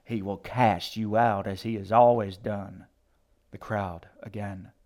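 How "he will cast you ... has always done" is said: loud, grating preacher's threat; harsh, emphatic damnation; grating condemnation. A narrator's reading. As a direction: pronounce judgment on a devil. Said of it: "voice grating against her teeth like stones".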